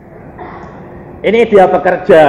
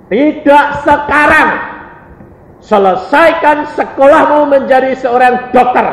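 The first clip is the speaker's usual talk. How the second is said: firm command, high and raised; perintah tegas; 9 semitones higher, 4 dB louder than usual. Retold or imitated. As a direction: lay down the order in a raised, high voice. Acts God's firm refusal. (memerintah tegas dengan suara tinggi)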